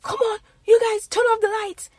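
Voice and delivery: mock a Nigerian father scolding about the lights; high, loud Nigerian accent nagging; Nigerian accent dad. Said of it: "in his accent, he'd be like".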